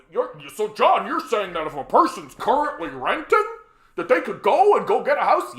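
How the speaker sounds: mock disbelief at the idea; mocking critic. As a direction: parody an incredulous opponent. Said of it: "like, oh".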